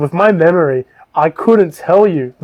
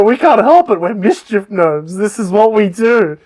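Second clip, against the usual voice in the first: higher-pitched mock protest; self-excusing gnome; 5 semitones higher than usual. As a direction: whine an excuse as a little gnome. Gnomes excuse themselves, acted.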